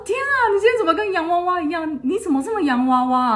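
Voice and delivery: mock wide-eyed gasp of wonder; amazed exclaim. Senior amazed at her dressed-up look.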